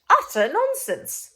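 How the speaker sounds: high, loud, drawn-out scoff at the idea; indignant protest. Acted. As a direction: scoff it out, outraged and slow. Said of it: "she protested".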